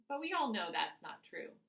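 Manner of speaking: usual speaking voice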